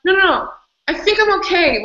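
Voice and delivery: high, hurried mock reassurance; brushing it off. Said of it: "I'm like".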